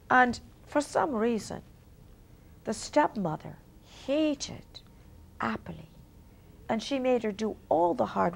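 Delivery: quietly ominous fairy-tale narration; hushed storytelling. A narrator's reading. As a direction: lean in and hush as the cruelty comes.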